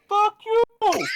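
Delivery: high, loud furious scream. Acted. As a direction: scream it in rage. Muffled shout from house.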